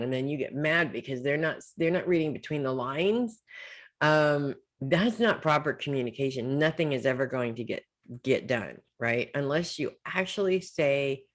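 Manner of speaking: ordinary talk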